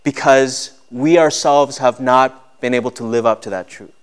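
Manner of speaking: high, loud preaching voice; impassioned preaching. Acted. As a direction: preach it out to the whole church. Preaching at full force.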